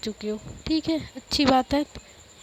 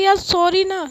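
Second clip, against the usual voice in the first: higher, louder pleading sorry; pleading apology; 6 semitones higher, 6 dB louder than usual. Acted. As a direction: beg her to forgive you, loudly. Friend begs forgiveness mid-quarrel.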